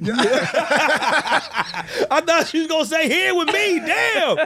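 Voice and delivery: laughing, high and slow. Real, not acted. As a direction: laugh through the words. Laughing at co-host's joke.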